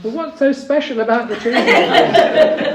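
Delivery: loud, high-pitched comic woman's voice; puzzled woman heckler. Acted. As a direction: call out an indignant question from the crowd. Acted woman heckler asks.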